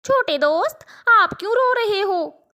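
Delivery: put-on a gentle girl asking a crying friend; kind little girl. Girl asks crying crow.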